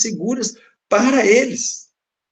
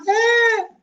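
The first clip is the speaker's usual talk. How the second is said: mock high girl's voice, much higher; imitating a girl. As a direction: squeak it like the little daughter. Filha atende o telefone. (voz de menina bem aguda, imitada)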